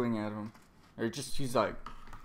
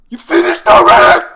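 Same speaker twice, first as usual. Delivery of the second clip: screamed mock taunt, very high and loud; Katakuri; 24 dB louder than usual. Villain mocking his beaten rival, high screamed taunt. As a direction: shriek it like a roaring zombie. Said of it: "that's what Katakuri's doing".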